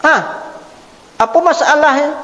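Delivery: a high startled "Hah?", then a puzzled question; startled host. Retold or imitated. Host startled by an unexpected guest, surprised. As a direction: jump in pitch like a host caught off guard. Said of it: "Orang tu pasti kaget".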